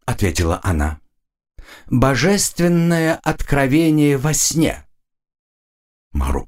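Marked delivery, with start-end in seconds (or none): 1.9-4.9 slow, haughty, high queen voice; evil queen. Acted. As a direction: drawl it out with royal disdain. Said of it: "Королева надменно посмотрела на графа".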